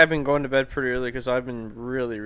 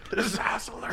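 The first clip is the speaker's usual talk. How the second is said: whispering; 5 semitones higher, 6 dB quieter, faster than usual. Pretend. Mock whisper scold.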